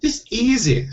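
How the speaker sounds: high, scolding Scottish wife; impatient stage-Scottish voice, high-pitched; snapped Scottish. A narrator's reading. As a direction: snap at him in a Scottish accent. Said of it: "snapped Jeanie".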